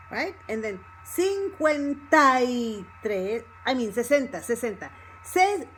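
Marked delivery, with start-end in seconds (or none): 1.0-3.4 "cincuenta y" joined, "ta-y" held long; words linked together. Shown as a demonstration. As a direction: stretch the stressed "tai" in "cincuenta y". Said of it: "everything together".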